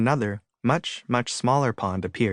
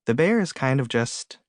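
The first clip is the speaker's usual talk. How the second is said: plain reading voice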